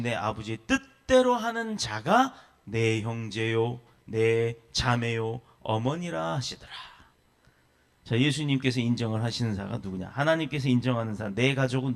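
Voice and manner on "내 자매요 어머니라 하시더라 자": ordinary talk, nothing marked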